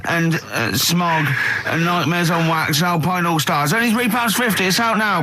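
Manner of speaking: rasping voiceover read; hoarse radio advert for the magazine. Retold or imitated. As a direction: push the ad through a sore throat. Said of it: "Have you got a sore throat there?".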